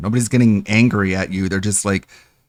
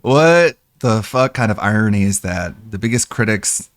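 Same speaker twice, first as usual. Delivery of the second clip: ordinary talk, nothing marked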